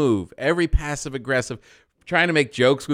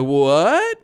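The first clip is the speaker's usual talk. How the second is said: raised, drawn-out "what"; fake ignorance; 5 semitones higher, 5 dB louder than usual. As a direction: play innocent, mock disbelief. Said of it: "fake ignorance".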